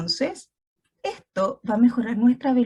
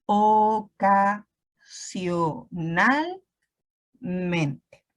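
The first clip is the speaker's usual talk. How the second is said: very slow, syllable by syllable; syllabic reading; 3 semitones lower, much slower than usual. Shown as a demonstration. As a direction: sound out each syllable like a beginner reader. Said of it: "Por ejemplo".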